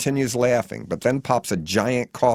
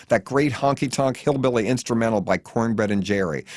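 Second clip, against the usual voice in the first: ordinary delivery, nothing marked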